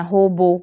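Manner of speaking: "ہوبو" said ho-bo, with o vowels; majhool mispronunciation. Shown as a demonstration. Teacher showing the wrong pronunciation, majhool o. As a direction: let "ہوبو" slip from oo to o. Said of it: "یہ غلط تلفظ ہے".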